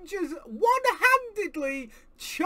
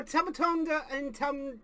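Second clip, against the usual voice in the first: ordinary talk, nothing marked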